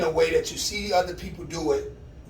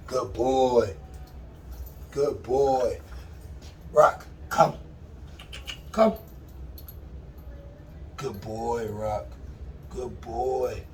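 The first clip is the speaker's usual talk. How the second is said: praising dog; 4 semitones lower, much slower than usual. Real, not acted. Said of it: "praising dog".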